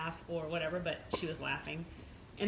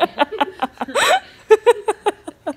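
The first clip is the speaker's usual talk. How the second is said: loud high laughter; 14 semitones higher, 18 dB louder than usual. Real, not acted. Big laugh.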